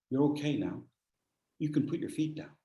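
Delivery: softly, calmly reassuring; calm reassurance. Retold or imitated. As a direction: talk him down gently.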